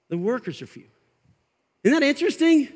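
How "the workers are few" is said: dropped, quiet emphasis. Shown as a demonstration. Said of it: "here's the but".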